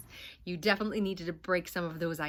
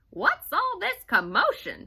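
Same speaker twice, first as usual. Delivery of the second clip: higher, louder startled question; Mrs Smoodle's question; 10 semitones higher, 6 dB louder than usual. Acted. Missus Smoodle asking the children, high and loud. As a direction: demand to know what the fuss is. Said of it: "she asked".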